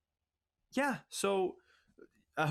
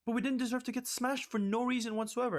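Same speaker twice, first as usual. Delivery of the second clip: angry rant; 7 semitones higher than usual. Real, not acted. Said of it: "I've never been more pissed".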